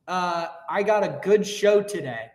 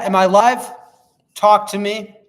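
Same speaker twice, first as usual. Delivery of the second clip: usual speaking voice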